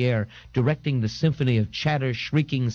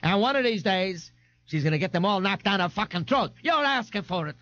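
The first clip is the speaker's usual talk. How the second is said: put-on high-pitched tough-girl threat; brash, threatening Frida; 9 semitones higher than usual. A narrator's reading. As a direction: threaten her in a rowdy high voice.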